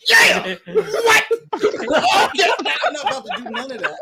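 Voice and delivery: a hammed-up half-laughing shout; mock shouting. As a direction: yell it while cracking up. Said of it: "I'm not about to be up here like".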